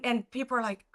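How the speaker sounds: normal talking voice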